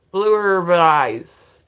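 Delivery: "Blue Herb Eyes" stretched out slowly and loudly; careful pronunciation attempt. Real, not acted. Thanking a viewer by username, tentative drawn-out pronunciation. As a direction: sound out "Blue Herb Eyes", dragging each word. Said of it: "I tried to pronounce that".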